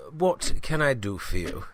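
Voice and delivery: ordinary talk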